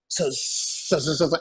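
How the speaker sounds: stuck repeating one word; imitated stutter. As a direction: trip over the same word, mimicking a stammer. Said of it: "He starts stuttering again".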